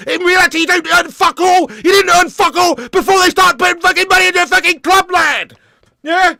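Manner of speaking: loud, high, shouted tirade; furious rant. Real, not acted. Fan ranting at chat about owners' money, shouting. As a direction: yell it at the fans, fuming.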